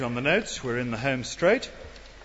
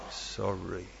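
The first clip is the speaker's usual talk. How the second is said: barely audible, much softer and much lower sorry; inaudible sorry; 9 semitones lower, 11 dB quieter than usual. Shown as a demonstration. Preacher mimics a child's reluctant apology, nearly inaudible. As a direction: mumble it so nobody can hear. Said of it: "And there's the inaudible one".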